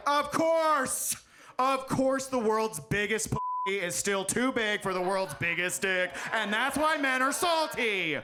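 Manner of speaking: high, drawn-out mock outrage; hammed-up indignant holler; mock revelation. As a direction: cry it out like a huge discovery. Acted loud realization after narrator's fact.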